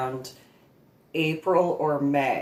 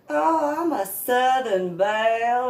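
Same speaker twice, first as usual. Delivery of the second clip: high, louder mock Southern belle; mock her Southern belle act; Southern belle accent, hammed up; fake Southern accent; 9 semitones higher, 4 dB louder than usual. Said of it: "she introduced".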